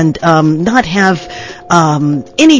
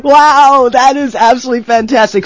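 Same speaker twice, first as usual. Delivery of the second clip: excited; 5 semitones higher than usual. Real, not acted. Host delighted guest returns.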